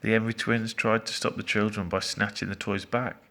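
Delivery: ordinary delivery, nothing marked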